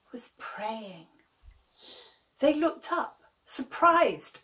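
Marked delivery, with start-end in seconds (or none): 0.1-1.1 soft unvoiced whisper; whispered narration. A narrator's reading. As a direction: drop to a hush. Narrator hushes.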